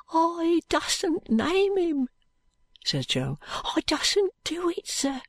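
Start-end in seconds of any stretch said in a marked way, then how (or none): 0.1-2.1 slow, high, fearful urchin. A narrator's reading. Jo afraid to name his persecutor, slow high voice. 3.5-5.2 slow, high, fearful urchin. A narrator's reading. Jo afraid to name his persecutor, slow high voice.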